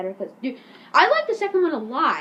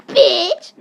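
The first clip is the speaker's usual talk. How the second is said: high, loud yelled insult; hammed-up shouted catchphrase; mock jeer; 5 semitones higher, 13 dB louder than usual. Imitating Eric Miller as sign-off, loud mock jeer. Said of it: "Eric Miller is gonna be like".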